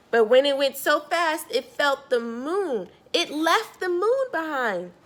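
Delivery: put-on lively storybook narration for kids; storyteller reading Harold to a young listener; reading to children. A narrator's reading. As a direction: read it out like bedtime story time to a child. Reads picture book aloud.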